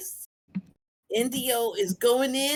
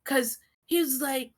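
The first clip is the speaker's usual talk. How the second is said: usual speaking voice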